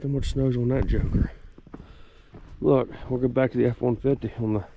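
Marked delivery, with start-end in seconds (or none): 2.6-4.6 talking with a flashlight in the mouth; words around a flashlight held in the teeth; mouth propped open. Real, not acted. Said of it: "I get the flashlight in my mouth".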